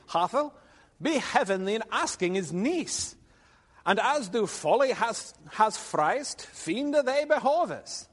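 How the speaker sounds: high, loud declaimed verse; Middle English verse read aloud. Acted. Lecturer reading Arthur's response to the class, declaimed Middle English. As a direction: declaim old verse with big pitch swings.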